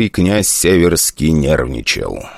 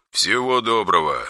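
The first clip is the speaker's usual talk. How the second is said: deadpan; 3 semitones higher, 6 dB quieter than usual. Acted. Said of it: "безэмоционально ответили ему".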